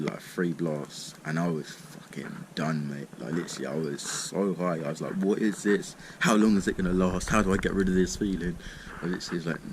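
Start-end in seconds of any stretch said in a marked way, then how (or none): 5.2-8.6 loud, alarmed questions; mock panic. Panicking while high retold.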